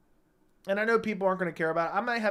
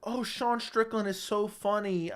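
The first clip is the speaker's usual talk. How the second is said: fawning fan impression; 3 semitones higher than usual. Mocking the MMA fans who adore Strickland, in a fawning giggly voice. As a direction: gush like a starstruck fan with a laugh. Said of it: "MMA community that's like".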